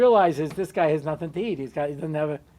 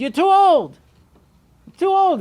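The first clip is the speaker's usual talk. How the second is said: high, loud scolding father; a father scoffing at his son, rising high and loud; 11 semitones higher, 7 dB louder than usual. Acted. Father brushing off his son's wish, high and loud.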